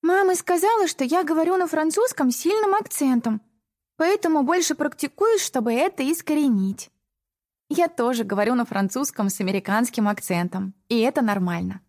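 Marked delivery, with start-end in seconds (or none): 0.0-6.9 put-on high, lively girl's voice; young girl voice. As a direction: voice the twelve-year-old higher. Narrator voices young sister.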